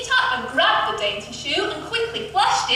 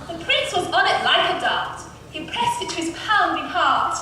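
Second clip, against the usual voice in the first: plain reading voice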